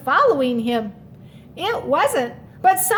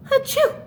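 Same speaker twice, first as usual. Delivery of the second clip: high sharp mock sneeze; a big squeaky achoo; 11 semitones higher than usual. A narrator's reading. Little Mouse sneezing from a snowflake. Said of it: "made him sneeze".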